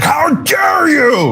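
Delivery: high, slow mocking shriek of outrage. Mocking The View hosts, a high slow outraged squeal. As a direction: drag it out in a shrill mock scandalized squeal. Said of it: "they were like".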